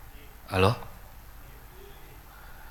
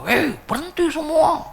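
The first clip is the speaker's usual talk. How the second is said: very high, loud mock outburst; imitated angry shout; 8 dB louder than usual. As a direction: yell a halt like the enraged widower. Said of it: "marah dia".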